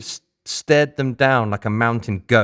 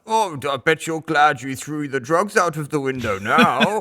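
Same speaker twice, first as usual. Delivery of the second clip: higher, slow, carefree blurt; relatively loud; 6 semitones higher than usual. Retold or imitated. Sound guy blurts in the silent holding room, too loud. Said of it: "in a relatively loud".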